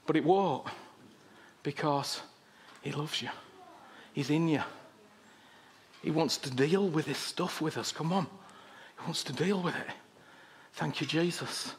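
soft prayer. Acted. Preacher turning to pray over the congregation, soft and low. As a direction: pray it gently under the breath.

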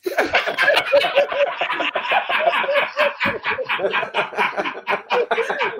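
bursting into high laughter. Real, not acted. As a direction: laugh hard, high pitched. Dad's call makes him laugh.

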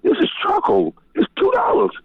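high, exasperated pleading over two dollars of charcoal; retold exasperation. Quoting himself to the cop.

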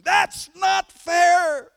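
very high, loud, drawn-out protest scream. Retold or imitated. Furious students protesting the grade, screaming high and slow. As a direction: howl it in outrage.

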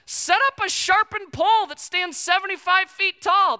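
high, loud mock scheming wife. Wife urging Haman, in a high loud mock voice. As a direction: ham up the wife's brilliant idea. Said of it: "She's equally intelligent".

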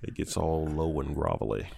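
smooth, soft and tired; tired low voice. Real, not acted. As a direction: let the tiredness sit in the voice. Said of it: "This is my tired voice".

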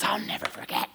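hushed, seething mock vow; imitated anger. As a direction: squeeze out the brother's anger under the breath. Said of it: "he's so mad".

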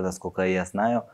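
normal talking voice

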